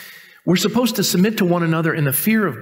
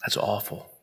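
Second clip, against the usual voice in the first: nearly whispered, much softer; hushed; 5 semitones lower, 13 dB quieter than usual. Real, not acted. Pastor quietly condemning husbands who demand submission, hushed. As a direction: drop it to a grave hush.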